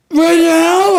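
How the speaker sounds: very high, loud cry of disbelief; panicked protest. Retold or imitated. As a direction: squeal it in mock horror. Acts out horrified reply to photo request.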